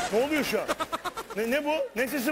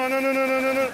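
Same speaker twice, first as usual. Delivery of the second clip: panicked wailing; 3 semitones higher, 6 dB louder, much faster than usual. Acted. Panicking on takeoff.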